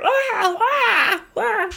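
high, squealing cries of panic; panicked crowd shrieks. Acted. Fleeing townsfolk shriek in high panic. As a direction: squeal like a scared crowd in a falsetto. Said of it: "the people that were queuing are".

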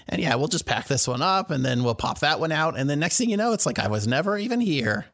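high, chatty merchant; shopkeeper voice. Acted. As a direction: play the shrewd shopkeeper.

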